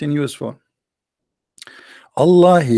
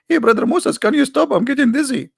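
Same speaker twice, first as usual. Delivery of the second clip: pitched way up, a dizzy onlooker calling out; high mocking believer voice; 11 semitones higher than usual. Mocking believer calls up to spinning Moses, much higher. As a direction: squeak it out high like a dizzy bystander.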